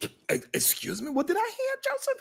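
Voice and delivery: high, incredulous, rising; mock disbelief. Host voices a shocked listener reacting to Joseph, high disbelief. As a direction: act floored by what you heard.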